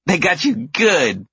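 put-on high, slow, giggly child voice; laughing little girl. A narrator's reading. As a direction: say it through kid giggles. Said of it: "laughs the older one".

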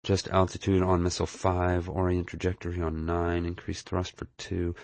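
absorbed, quiet running commentary to oneself; mumbling commentary. A narrator's reading. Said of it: "giving a mumbling commentary".

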